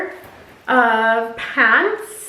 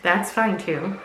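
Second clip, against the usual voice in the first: ordinary delivery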